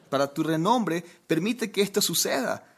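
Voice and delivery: high-pitched, hammed-up plea; long-winded parody of an emergency prayer; mock frantic prayer. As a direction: pray in comic urgency, pitch lifted throughout. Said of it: "sería algo así".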